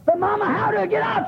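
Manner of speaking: high shouted plea of the little eagle; young eagle. Acted. Eaglet shouting up to its mother, begging for a way out. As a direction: cry up to mama from the ground.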